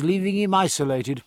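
plain reading voice

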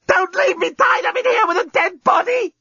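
very high-pitched panicked scream. A narrator's reading. Captive tied up beside a body, screaming. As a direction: shriek the whole plea at the top of your range. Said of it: "screamed Lucy".

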